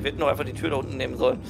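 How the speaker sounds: ordinary talk, nothing marked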